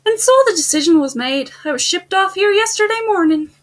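put-on high-pitched Irish accent. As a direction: read it as the Irish student character. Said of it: "let me get into my Irish accent".

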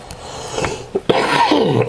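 quick clearing of the throat. Real, not acted. Clears throat before going on.

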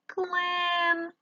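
Клен with one long, high е; Клен held out on a high note; stressed check word. Shown as a demonstration. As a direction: stretch the vowel in Клен, holding it high. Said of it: "Підбираємо споріднене".

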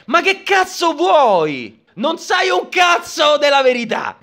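slow, then shouting in disbelief; angry rant. Real, not acted. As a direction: yell it at her, fed up. Ranting at Catelyn on screen.

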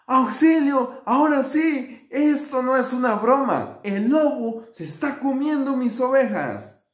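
high, loud, urgent acted cry; storyteller's hammed-up alarm call; shouting for help. A narrator's reading. Shepherd boy faking a wolf attack, high urgent shout. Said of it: "volvía a gritar".

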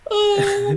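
high, drawn-out "ohhh"; retold dismayed "oh". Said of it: "we were just like".

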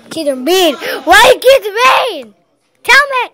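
loud, high, slowed complaint; upset shout. Real, not acted. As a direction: demand an answer, upset. Upset at kids.

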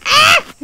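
very high, loud shriek; short scream of alarm; startled scream. Real, not acted. Player who pissed off the creature, screaming.

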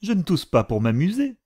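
put-on high, sour girl's retort. A narrator's reading. Kitty answers her mother back, sour and high. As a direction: snap back, pitched well up. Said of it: "reprit Kitty d'un ton aigre".

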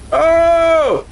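sudden high loud yelp; high cry of surprise; startled yelp. Real, not acted. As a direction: jump at something on screen.

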